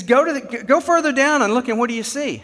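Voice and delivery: urgent command. Retold or imitated. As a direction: urge the crew down the line. Foreman urging crew further down.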